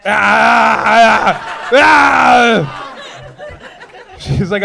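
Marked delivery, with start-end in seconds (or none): 0.1-2.9 mock shouting cry. Comic mock outburst at audience member.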